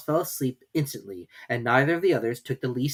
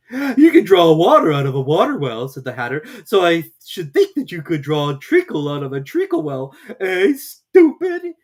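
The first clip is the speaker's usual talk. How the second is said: higher, louder, mocking Hatter; smug Hatter; 5 semitones higher, 10 dB louder than usual. A narrator's reading. Hatter correcting Alice at tea, smug and loud. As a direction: lord it over her, loud and pleased. Hatter voiced in read story.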